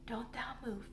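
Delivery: soft unvoiced whisper; low voice. A narrator's reading. Boy whispers to Mary not to frighten the animals. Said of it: "a voice almost as low".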